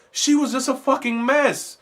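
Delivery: usual speaking voice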